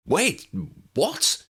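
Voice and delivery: high startled splutter. A narrator's reading. Harry hearing Ginny will go instead, spluttering in shock. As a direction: caught totally off guard. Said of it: "Harry spluttered".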